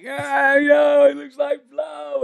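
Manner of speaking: high, drawn-out mock holler; acted calling out. Joking to two cops about the salt, mock hollering. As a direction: stretch it out in a high, slow call.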